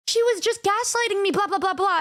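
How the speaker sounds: mocking Michi. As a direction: parody her excuse, pitched high. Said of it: "she's like".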